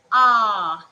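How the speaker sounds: "ah" with the mouth wide open, loud; teacher modelling an open "ah". Shown as a demonstration. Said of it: "You have to open your mouth wide".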